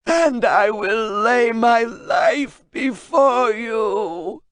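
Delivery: high, very slow, shouted through sobs; sobbing reading. Acted. Translator reading the final rune, sobbing shout. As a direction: read it out while sobbing.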